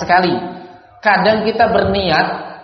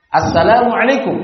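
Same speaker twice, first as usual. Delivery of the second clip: ordinary talk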